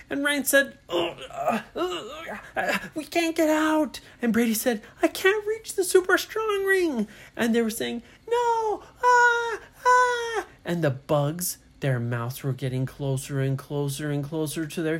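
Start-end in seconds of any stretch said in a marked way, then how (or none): 0.9-4.0 high-pitched grunting and straining; trapped struggling. Acted. Trapped characters struggling against bugs. 5.0-7.1 high, urgent storybook panic; panicked straining. Acted. Trapped Brady panics in the story. 8.3-10.5 high-pitched acted screams of alarm; terrified cries. Trapped children cry out at bugs.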